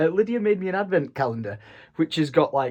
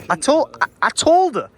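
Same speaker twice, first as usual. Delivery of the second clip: raised, higher mock scolding; mock telling-off; 7 semitones higher, 4 dB louder than usual. Son mock-scolding his dad over the parking, raised voice. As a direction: play the exasperated son.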